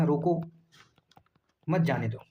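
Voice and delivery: long comma pause after रोको, then मत जाने दो together. Shown as a demonstration. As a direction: break clearly after रोको. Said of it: "यहाँ पे कॉमा लगा दिया".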